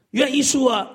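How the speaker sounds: normal talking voice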